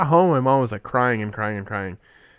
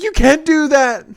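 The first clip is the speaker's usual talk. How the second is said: high, loud, indignant scolding; mock outraged mother; upset mom; 8 dB louder than usual. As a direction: imitate a shaken mom telling him off. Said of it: "she's like".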